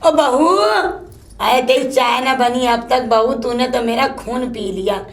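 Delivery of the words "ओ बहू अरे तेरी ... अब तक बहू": normal talking voice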